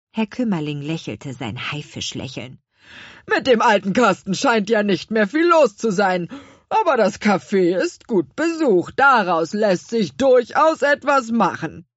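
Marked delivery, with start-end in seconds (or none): 3.3-11.9 louder, oily, gloating rich man voice; pompous baker gloating over the café; smug fat baker. Acted. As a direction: savour every word like a villain.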